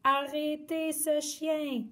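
high call to stop; shouting. Acted. Everyone yelling at the dog, high call. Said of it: "ont-ils tous crié".

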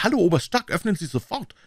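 high desperate cry for help; yelling to be let out; shrill yell. Acted. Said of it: "schrie ich gellend".

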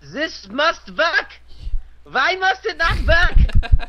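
very high, slow, loud mock-German yell; drawn-out German-accented shriek; Germanic accent shout. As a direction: rant in a hammed-up German accent. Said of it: "a Germanic accent".

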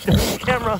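amused, laughing telling-off; laughing warning. Real, not acted. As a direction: scold the pig through a laugh. Pig nibbling the camera.

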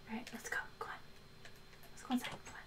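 hushed, coaxing the dog along; whispered come-along to a pet; soft coaxing. Real, not acted. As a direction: quietly call the dog inside.

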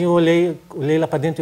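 ordinary delivery

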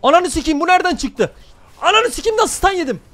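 high, loud outraged yelling; furious shout. Real, not acted. Streamer caught by a surprise stun, furious shout. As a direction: scream it at the screen in disbelief. Gets stunned unexpectedly in game.

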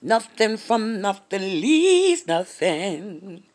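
each word stretched slowly; slow proverb recital; slow, drawn-out saying. Retold or imitated.

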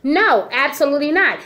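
usual speaking voice

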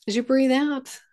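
ordinary talk

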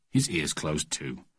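ordinary delivery

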